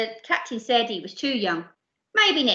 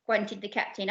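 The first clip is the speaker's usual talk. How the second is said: usual speaking voice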